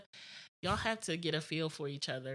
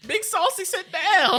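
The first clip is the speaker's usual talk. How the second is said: much higher, louder, laughing command; laughing mock order; 14 dB louder than usual. Mocking her co-host's dominatrix act, laughing order.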